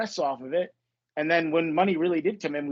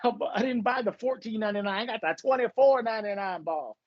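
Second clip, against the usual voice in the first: higher, showy fake-rich bragging; mock baller boast; 6 semitones higher than usual. Joking with a friend about buying a ball, mock boast. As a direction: brag about a cheap ball like it's a fortune. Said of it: "we started talking like that".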